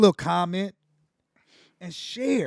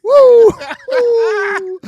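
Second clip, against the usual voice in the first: excited whoop; 14 semitones higher, 14 dB louder than usual. Real, not acted. Cheering the pun.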